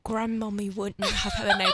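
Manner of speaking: put-on British royal accent; Prince Harry's British accent. Host doing a royal impression for co-host, British accent. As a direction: play a British prince, amused. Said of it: "Do you wanna hear my Prince Harry impression?".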